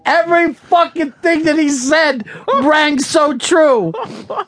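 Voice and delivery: high, slow, cry-laughing; laughing through tears; laughing hard. Real, not acted. Laughing crying at insult.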